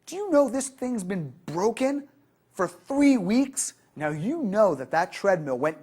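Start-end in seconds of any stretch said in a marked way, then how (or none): 0.0-3.7 irritated member, high and drawn out; angry complaint. Retold or imitated. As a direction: complain loudly about a broken machine. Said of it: "say something angrily like".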